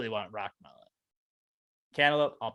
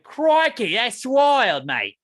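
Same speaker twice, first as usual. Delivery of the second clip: loud, high hammed-up Australian; over-the-top Australian exclamation, shooting up high; Australian accent; 11 semitones higher, 9 dB louder, much slower than usual. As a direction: holler it like a cartoon Australian. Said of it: "They're like".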